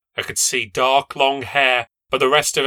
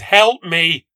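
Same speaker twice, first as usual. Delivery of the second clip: louder plea for help; desperate shout; 5 semitones higher, 7 dB louder than usual. A narrator's reading. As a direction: cry out for help from the stairs. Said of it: "I loudly, desperately shouted".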